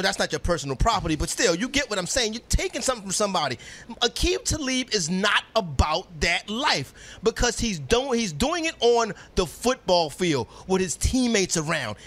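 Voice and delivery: normal talking voice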